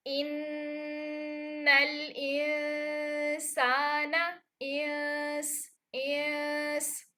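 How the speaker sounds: slow, drawn-out nasal ikhfa on इंस with the tongue kept flat; thin-tongued ikhfa recitation. Shown as a demonstration. Teacher demonstrating ikhfa recitation slowly to students. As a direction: stretch इंसाना slowly, hiding the noon in the nose. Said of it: "तो कैसी sound आएगी?".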